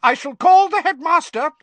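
teacher threatening Peeves; McGonagall shouting. Acted. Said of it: "shouted Professor McGonagall".

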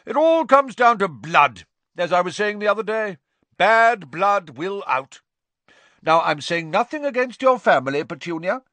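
put-on loud, high, bossy old aunt; Aunt Marge voice. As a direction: boom it out like a pompous aunt.

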